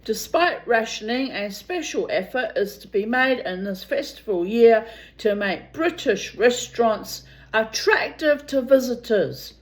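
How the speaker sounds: ordinary talk, nothing marked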